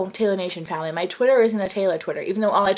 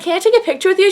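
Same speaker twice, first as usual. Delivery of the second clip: high, cutesy mimicked request; really cute; 8 semitones higher, much faster than usual. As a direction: imitate her asking adorably, pitched up. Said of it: "like, really, like, cute".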